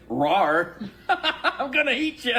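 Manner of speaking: high, laughing mock bear growl. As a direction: play a silly bear about to eat you. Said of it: "a bear would be like".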